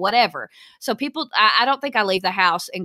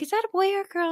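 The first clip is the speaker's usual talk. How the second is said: nosy stranger; 10 semitones higher, 3 dB quieter, much faster than usual. Retold or imitated. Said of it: "so she was like".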